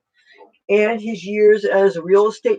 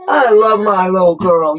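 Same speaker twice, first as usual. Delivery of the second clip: louder, fond declaration to the pet; louder affection; 6 dB louder than usual. Real, not acted. Telling her pet Cassie she loves her, louder.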